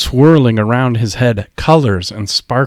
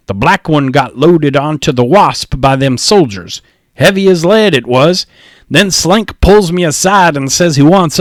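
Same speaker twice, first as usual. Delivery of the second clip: higher, louder rough dockhand voice for Mac; sailor Mac; 4 semitones higher, 3 dB louder than usual. Acted. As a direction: play Mac as a grumbling sailor spinning his tale.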